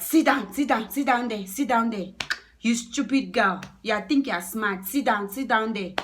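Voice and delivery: loud, high, repeated barked orders; acted scolding man, contemptuous; angry command. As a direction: snap at someone to sit. Acting the angry man giving orders.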